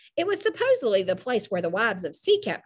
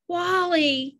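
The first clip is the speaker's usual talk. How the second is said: higher, drawn-out feeble call; weak, near tears; 7 semitones higher than usual. A narrator's reading. Caroline on the stretcher, weakly near tears. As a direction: say it as though about to cry. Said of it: "she said weakly, sounding as though she might cry".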